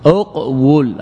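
اقول said uq-wul, both syllables separated; original form, u-onset. Shown as a demonstration. Teacher recapping the origin, اقول split as uq-wul. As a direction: stop on the q of اقول, then say wul. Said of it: "هذا الأصل".

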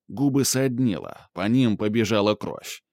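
usual speaking voice

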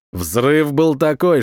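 usual speaking voice

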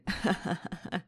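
breathy leering cackle; hyena cackle. A narrator's reading. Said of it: "его мерзкий, как у гиены, хохот".

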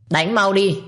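rít cao, gắt và to; thét lệnh đánh; rít lên. A narrator's reading. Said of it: "Giọng của bà ấy rít lên". (bà hai rít lên giục đánh Quỳnh)

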